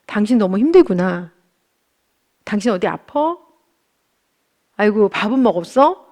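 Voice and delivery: gentle concern. Retold or imitated. Husband comforting his exhausted wife, gently caring. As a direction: tenderly check on someone worn out. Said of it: "이렇게 좀 부드럽게 끌어준다면".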